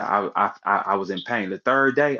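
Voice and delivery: ordinary talk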